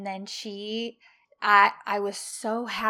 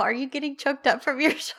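quick, higher teasing impression of husband, laughing through it; 5 semitones higher, 5 dB louder, much faster than usual. Husband catching her tearing up at the show, quick teasing with a laugh. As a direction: poke fun at her tears, fast and amused. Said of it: "and he's all".

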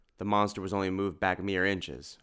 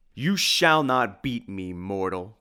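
slow, loud, defiant cry; Dracula; 2 semitones higher, 7 dB louder, slower than usual. A narrator's reading. Dracula cries to the heroes as he echoes away, defiant villain cry. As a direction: thunder it like a villain. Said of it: "cried the voice of Dracula".

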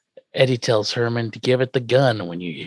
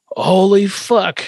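shocked; 8 semitones higher, 4 dB louder, much slower than usual. Retold or imitated. Grandpa shocked exclamation.

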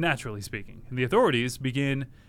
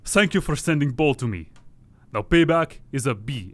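louder fake Russian kidnapper; mock Russian accent; 3 dB louder than usual. Kidnapper's text read aloud in a mock Russian accent. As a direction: menace it out in a hammed-up Russian accent. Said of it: "that's a Russian man".

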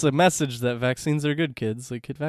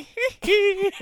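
very high falsetto squeal; mock pop-star falsetto yelp. Disguised spy posing as pop star, mock falsetto yelp. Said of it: "is that [name]?".

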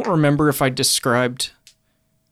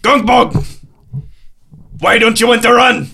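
bellowing over the wind; 8 semitones higher, 6 dB louder than usual. Acted. Gruff Scottish captain voice.

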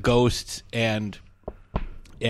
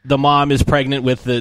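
ordinary talk

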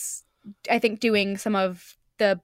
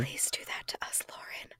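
hushed whispered plea; whispering; 16 dB quieter than usual. Real, not acted. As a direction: whisper it like a secret wish. Joking plea to absent creator.

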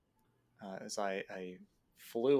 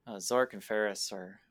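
ordinary talk

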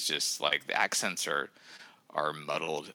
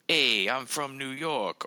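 loud, slow, hammed-up New York accent; fake New York accent; 8 semitones higher, 4 dB louder, slower than usual. Mocking British actors' American accent, in exaggerated New York. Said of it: "who cannot do a flat American accent".

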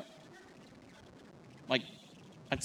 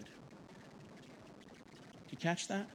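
whisper; 4 semitones higher, 16 dB quieter, much slower than usual. Real, not acted. Preacher whispers rhetorical question.

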